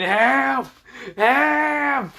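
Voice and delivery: high desperate screams; screaming for help. Acted. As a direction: scream it out in pain. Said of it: "screaming in pain".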